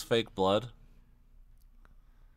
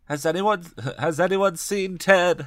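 higher-pitched anxious question; mildly raised, urgent asking; crying out; 7 semitones higher, 3 dB louder than usual. A narrator's reading. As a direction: cry it out to the room. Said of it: "Hallie cried".

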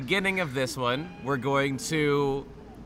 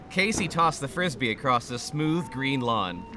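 ordinary delivery

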